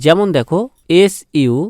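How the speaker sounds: normal talking voice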